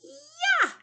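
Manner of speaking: sharp high shouted "Ya."; karate chop yell. Shown as a demonstration. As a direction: chop the air with a loud "Ya.". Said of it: "Make that Y with your arms".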